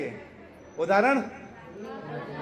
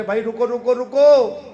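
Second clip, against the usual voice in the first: calling out to a crowd, building louder; mock announcement; 7 dB louder than usual. Krishna halting the crowd of gopis by microphone, mock calling out. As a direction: call "stop" over an imagined loudspeaker, last one loudest. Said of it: "भगवान ने सबको mic लगाया".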